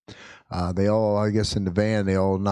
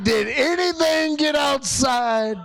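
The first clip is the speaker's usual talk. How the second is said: high, drawn-out mock yell; retold exasperated shout; slower than usual. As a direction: shout in hammed-up disbelief. Acts out yelling at sick child.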